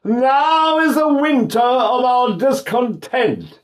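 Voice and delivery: high, slow, open declamation; slow theatrical line, jaw released; relaxed open delivery. Shown as a demonstration. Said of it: "now I've got all my vowels back here".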